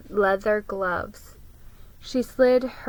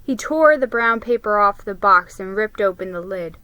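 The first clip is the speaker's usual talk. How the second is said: usual speaking voice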